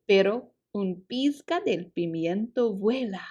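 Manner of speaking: usual speaking voice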